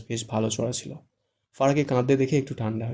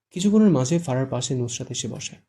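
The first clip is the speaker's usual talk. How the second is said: ordinary delivery, nothing marked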